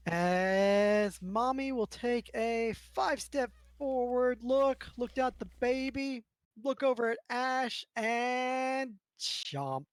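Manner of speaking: loud, high, slow build to the bite; dramatic narration. A narrator's reading. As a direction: ham up the monster's attack. GM narrates shark attack dramatically.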